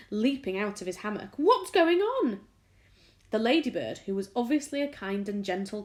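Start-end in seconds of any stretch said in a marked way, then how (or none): 1.4-2.5 high, loud, slow bewildered question; alarmed cry. A narrator's reading. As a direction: call out confused. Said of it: "cried James".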